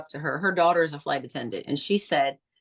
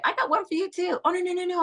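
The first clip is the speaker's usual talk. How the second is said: excited exchange; 11 semitones higher, faster than usual. Retold or imitated. Gift offered and refused.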